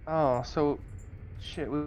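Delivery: ordinary talk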